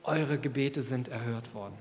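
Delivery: the ad slogan echoed softly and solemnly; hushed slogan. Retold or imitated. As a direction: say it quietly like a prayer. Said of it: "Hände erhoben im Gebet".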